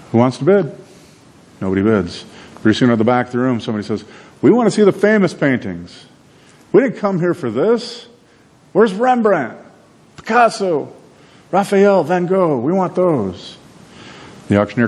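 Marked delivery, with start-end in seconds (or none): 0.1-0.8 higher, pleading call for bids; auctioneer asking. Retold or imitated. Auctioneer asking a silent room for bids, higher pitch. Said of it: "He's like". 4.4-13.6 much higher, indignant demanding voice; demanding buyer. Retold or imitated. Art buyer at the back demanding famous paintings, high indignant.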